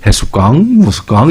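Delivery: light Jeju dialect voicing; Jeju dialect reading. Preacher recalling a Jeju-dialect Gospel reading. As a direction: read the verse in Jeju dialect. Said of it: "제주도 방언 버전으로".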